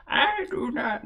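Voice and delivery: put-on high, thin old woman voice; old lady voice. Shown as a demonstration. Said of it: "should I do an old lady voice for this?".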